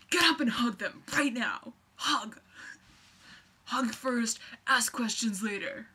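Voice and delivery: loud barked drill sergeant orders. Retold or imitated. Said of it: "I sound like a drill sergeant".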